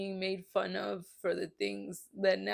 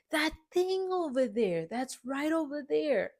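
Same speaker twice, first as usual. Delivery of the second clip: imitating mom pointing; 7 semitones higher than usual. Mimicking mom pointing at things.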